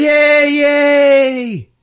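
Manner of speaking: loud, high cheering; crowd cheer. Retold or imitated. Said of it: "And everybody".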